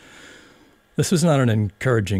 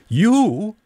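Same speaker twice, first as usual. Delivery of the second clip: higher, louder scornful snort of a word; contemptuous; 7 dB louder than usual. A narrator's reading. Caterpillar scoffing at Alice, contemptuous. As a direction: sneer at her. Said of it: "said the caterpillar contemptuously".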